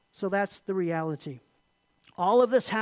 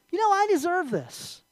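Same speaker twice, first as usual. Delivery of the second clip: higher-pitched, self-entitled insistence; enemy's lie; 9 semitones higher than usual. Acted. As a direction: lightly act an entitled inner voice. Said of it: "one lie we often hear from the enemy".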